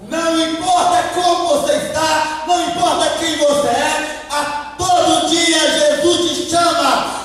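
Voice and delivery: loud, high, chanting list; full-force preaching. Real, not acted. Preacher telling everyone Jesus calls them, shouting. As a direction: hammer each phrase out in rising fervour.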